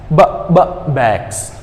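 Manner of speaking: "b, b, bags" with emphatic lip pops; sound drill. Shown as a demonstration. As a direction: pop "b" twice before "bags". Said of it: "the other word is".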